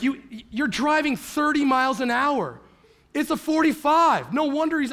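high, loud mock yelling; wife's exasperated scolding. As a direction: imitate an incredulous wife telling off the driver. Wife scolds him for slow driving.

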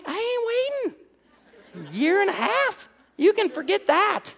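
high-pitched comic refusal; mock protest. Young man telling fiancée he won't wait, high mock protest. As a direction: voice shoots up, imitating his impatient younger self. Retold protest at waiting to marry.

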